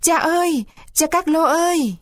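high cry for help to his father. Acted. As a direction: call out high and desperate for papa. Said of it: "Buratino chỉ kịp hét lên mấy tiếng".

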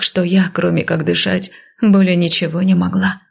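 ordinary delivery

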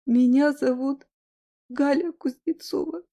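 sobbing, voice rising very high; breaking into tears mid-plea; crying on the phone. Acted. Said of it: "Она разрыдалась прямо в трубку". (рыдает в трубку)

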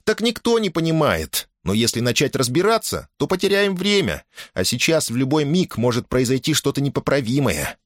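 put-on high-pitched, pressing girl's voice; urgent girl. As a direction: urge them on, then reproach yourself. Girl urges men to hurry.

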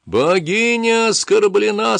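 high, slow ranting shout; yelling priest. Acted. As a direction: cry it out to the crowd. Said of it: "снова завопил жрец".

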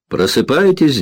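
bright, high wake-up call; cheerful shout. A narrator's reading. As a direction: call out gaily to the room. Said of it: "весело крикнула". (весёлый выкрик)

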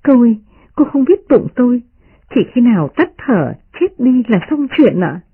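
a hint of a maid urging caution; gentle maid pleading. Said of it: "Tập Nhân cười nói".

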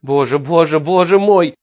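tearful panicked praying; starting to cry. A narrator's reading. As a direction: voice breaking into tears. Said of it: "Рой начинал плакать". (начинает плакать от страха)

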